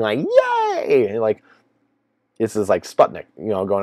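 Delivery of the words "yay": sarcastic cheer, much higher; mocking cheer from the sofa. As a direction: squeal a fake little yay. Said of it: "like, cheering".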